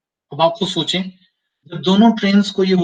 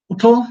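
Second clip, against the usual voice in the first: उठो set apart, high and loud; comma after उठो; 6 semitones higher, 5 dB louder, slower than usual. Shown as a demonstration. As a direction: punch उठो alone, then stop. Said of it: "उसके बाद एक comma".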